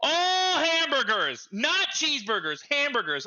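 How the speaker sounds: slow, loud, high disbelief; realizing the order was hamburgers all along; incredulous. Retold or imitated. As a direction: drag out the realization, stunned. Realizing the mistake mid-story.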